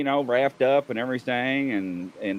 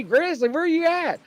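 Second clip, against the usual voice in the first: mock holler. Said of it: "he's hollering for me".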